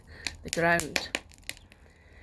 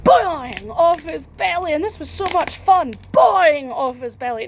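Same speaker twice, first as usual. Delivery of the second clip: gleeful bouncing; 5 semitones higher, 5 dB louder than usual. A narrator's reading. Narrator delighting in the girl bouncing on the belly, higher and gleeful. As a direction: relish every bounce like a delighted child.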